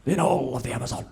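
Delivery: slow reverent British presenter voice; Attenborough impression. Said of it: "He'll be like".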